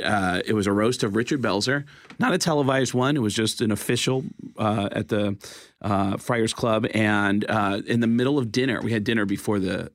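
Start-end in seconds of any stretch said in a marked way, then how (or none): none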